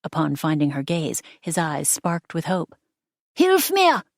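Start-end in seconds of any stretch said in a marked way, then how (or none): 3.3-4.0 very high, loud plea; desperate cry for help. A narrator's reading. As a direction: shout up for rescue while dangling. Dangling man begs for help.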